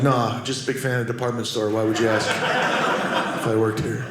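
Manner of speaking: low, understated deadpan brush-off; mock innocent denial. Retold feigned innocence to customer.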